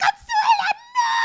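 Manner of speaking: high, loud, wailing cry of despair; anguished cry. Retold or imitated. Imitating the heartbroken boyfriend on the show, a high wailing cry. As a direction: wail it like your heart is breaking. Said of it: "And he's like".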